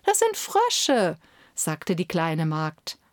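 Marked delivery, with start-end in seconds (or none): 0.1-1.2 high, slow patient correction; gentle little maid. Acted. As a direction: correct them kindly like a small girl. Kitchen girl corrects courtiers.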